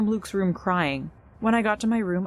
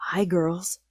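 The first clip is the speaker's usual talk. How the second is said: fake guy voice. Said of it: "she said in her fake guy voice".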